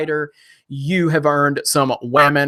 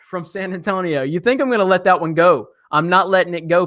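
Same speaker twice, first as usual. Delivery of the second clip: normal talking voice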